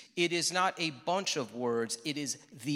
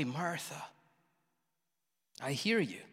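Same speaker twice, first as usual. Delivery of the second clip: softly, quietly reassuring Jesus; gentle Jesus; 6 dB quieter than usual. Acted. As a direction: soothe her gently, without blame. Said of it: "in that gentle way".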